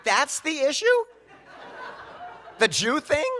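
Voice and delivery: loud, high-pitched disbelief; incredulous outburst. Retold or imitated. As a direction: throw it back in loud, astonished questions. Incredulous comeback to mom's objection.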